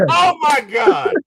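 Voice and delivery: high, loud, slow shout of awe; excited shout. Real, not acted. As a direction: let it burst out in awe. Hyped retelling Endgame scene.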